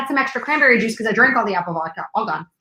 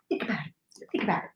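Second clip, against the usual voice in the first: whispered aside; 2 semitones lower, 13 dB quieter than usual. Acted. Aside after telling a story.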